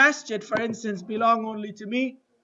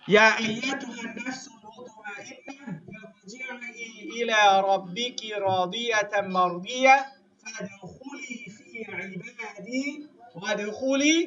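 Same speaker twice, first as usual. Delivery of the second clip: fast chanted recitation of the verse; Quran recitation; 4 dB quieter, much faster than usual. Acted. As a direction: recite it in a flowing tajweed chant.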